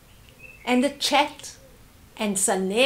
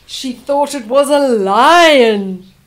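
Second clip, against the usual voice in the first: slow, much louder, big and booming; dramatic loud telling; 12 dB louder, slower than usual. Acted. Storyteller scaring the listening kids, slow booming lion line. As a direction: make the children jump at the lion.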